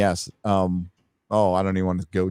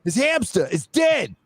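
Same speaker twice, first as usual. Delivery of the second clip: high-pitched cartoon mobster; over-the-top gangster threat; mock hitman; 13 semitones higher than usual. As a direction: announce the hit in a hammed-up high voice. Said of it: "trying to put hits on people".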